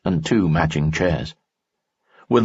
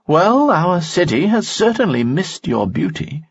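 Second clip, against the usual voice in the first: higher, courtly nobleman voice; a charming noble, pitched up; Lanson's voice; 12 semitones higher than usual. Acted. Lanson speaks in story.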